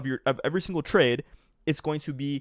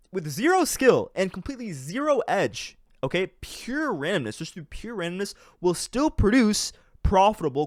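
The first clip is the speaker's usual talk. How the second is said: emphatic; 6 semitones higher, 3 dB louder than usual. Real, not acted. Emphatic point about pure randomness.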